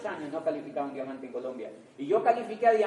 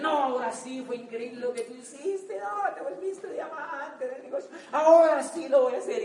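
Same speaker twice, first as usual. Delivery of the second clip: mock eager doctors; 8 semitones higher, 5 dB louder than usual. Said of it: "decían".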